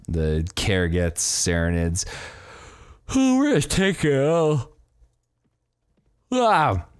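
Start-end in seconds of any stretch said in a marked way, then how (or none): 3.1-4.7 gleeful. Real, not acted. Gleeful conquering boast. 6.3-6.9 big open yawn; loud yawn. Real, not acted. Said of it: "Excuse my yawn".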